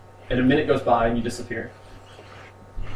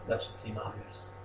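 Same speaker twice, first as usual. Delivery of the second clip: whispered herald; 2 semitones lower, 13 dB quieter than usual. Acted. Goddess herald speaks mysteriously.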